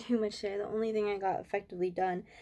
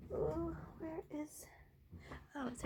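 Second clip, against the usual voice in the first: whispered search; 6 semitones higher, 11 dB quieter than usual. Real, not acted. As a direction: whisper to yourself while looking. Searching for something.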